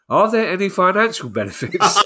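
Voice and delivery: reading while cracking up; words breaking into laughter; laughing. Real, not acted. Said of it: "I'm sorry for laughing".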